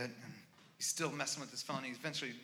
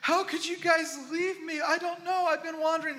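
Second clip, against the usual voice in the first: put-on whiny drunk kid; 8 dB louder than usual. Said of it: "He's like".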